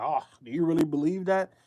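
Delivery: skeptical mock voice; lightly voiced skeptic; mocking Anton's doubt. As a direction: sneer it like a doubter. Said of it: "He was like".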